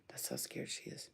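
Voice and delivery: unvoiced hush so as not to startle the dog; whispering beside her frightened dog. Real, not acted. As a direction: whisper it softly.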